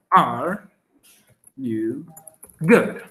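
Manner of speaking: Are... you... good? said word by word with pauses. Shown as a demonstration. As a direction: split "Are you good" into slow separate words for the class.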